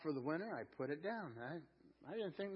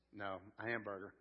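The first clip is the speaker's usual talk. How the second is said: ordinary talk, nothing marked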